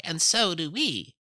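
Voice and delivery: high, loud, slow little bat voices; crying bats. A narrator's reading. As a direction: squeak it out like sleepy bats. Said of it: "they cry".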